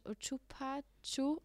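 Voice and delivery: "U Čupa Ču" slow, syllable by syllable; slow teaching. Shown as a demonstration. Teaching her friend the Croatian curse, slowly. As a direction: spell it out slowly. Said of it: "Bring mir das bei".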